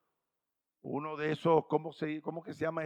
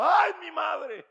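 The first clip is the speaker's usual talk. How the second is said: high, slow, loud terrified cry; desperate scream; 12 semitones higher, 5 dB louder, much slower than usual. Acted. Preacher acting the men's desperate scream, high and slow. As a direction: scream it out in despair. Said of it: "dieron un grito desesperado".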